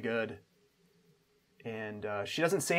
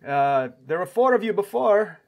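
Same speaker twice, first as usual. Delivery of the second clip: louder, raised host voice; questioning host; 6 semitones higher, 7 dB louder than usual. Acted. Game master as camp host questioning the guests, loud and higher. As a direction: query the guests in a loud, lifted voice. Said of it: "comes over and says".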